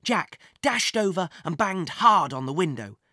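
plain reading voice

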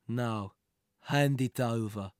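low, slow threat; low menacing demand. A narrator's reading. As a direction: say it slow and dangerous. Gunman demands the treasure.

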